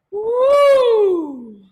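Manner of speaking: loud, high siren whoop gliding down; loud sliding "woo"; vocal siren. Shown as a demonstration. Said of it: "We're gonna do some sirens".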